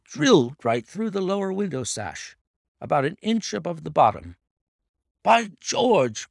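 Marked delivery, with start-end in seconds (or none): 5.2-6.3 high, loud startled exclamation; excited cry. A narrator's reading. Inspector marvelling at Holmes's find, loud excited cry. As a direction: burst out in amazement. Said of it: "cried the inspector".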